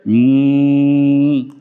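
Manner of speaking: a long low steady hum on m, lips closed; long humming m. Shown as a demonstration. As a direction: hold a slow nasal hum like a bee, breathing out through the nose. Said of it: "सिर्फ ऐसे किया". (लंबी धीमी गुनगुनाहट, म की ध्वनि)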